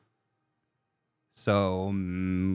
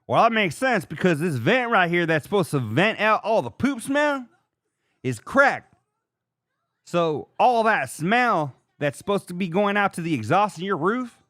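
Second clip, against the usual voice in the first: higher, louder know-it-all plumber; plumber explaining; 8 semitones higher, 4 dB louder than usual. Retold or imitated. Plumber explaining the cracked vent, in a higher, louder voice. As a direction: lecture it like the expert plumber. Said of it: "plumber explaining the cracked vent".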